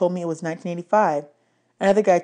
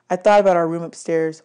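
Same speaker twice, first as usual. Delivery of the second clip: ordinary delivery, nothing marked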